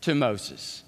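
ordinary talk

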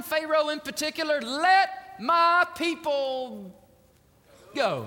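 high, forceful commissioning voice; impassioned preaching. Acted. God commissioning Moses to face Pharaoh, impassioned preaching.

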